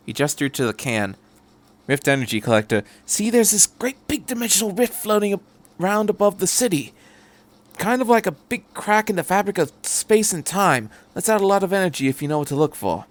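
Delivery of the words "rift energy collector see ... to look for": rambling, higher eccentric stranger; chatty Doctor. Acted. The Doctor rambling to Shinji about the tape, chatty. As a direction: babble happily about gadgets.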